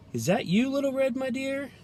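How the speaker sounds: lightly voiced granny calling through the door. A narrator's reading. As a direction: call out like a frail grandmother. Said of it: "called Granny".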